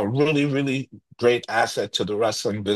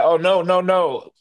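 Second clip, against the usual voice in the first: louder, higher, imitating a driver's firm no; mock refusal; 6 semitones higher, 4 dB louder than usual. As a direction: wave him off, playfully loud. Driver refusing the Boston trip.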